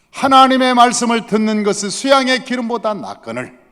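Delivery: loud, high scolding proclamation; angry rebuke. Acted. Samuel rebukes Saul for not listening, angry. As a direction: thunder the reproach at the listener. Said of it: "이렇게 화를 내서 얘기하는".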